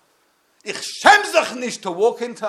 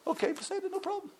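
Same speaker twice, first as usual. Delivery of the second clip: high, soft, offhand okay; casual shrug; 6 semitones higher, 17 dB quieter, faster than usual. Pretend. God glancing down at careless tefillin, high and soft. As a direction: brush it off lightly like it's nothing.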